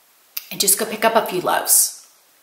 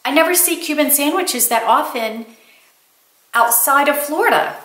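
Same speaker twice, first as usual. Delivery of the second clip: excited exclamation; 6 semitones higher than usual. Retold or imitated. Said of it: "And I went".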